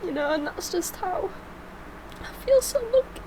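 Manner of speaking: choked-up and tearful, voice climbing high; fighting back tears while thanking her husband; breaking into tearful little laughs; emotional. Real, not acted. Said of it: "no wonder she becomes so emotional".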